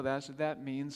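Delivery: ordinary delivery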